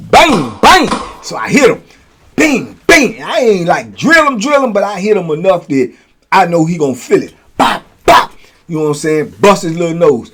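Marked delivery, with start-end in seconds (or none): 0.1-0.9 imitated punch sounds. 7.6-8.3 imitated punch sounds.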